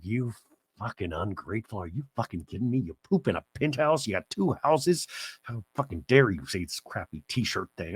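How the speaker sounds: low, soft muttered fury; husband muttering his outrage, low and soft; mock grumbling rant. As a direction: grumble under the breath like an offended husband. Terry's imagined angry inner rant.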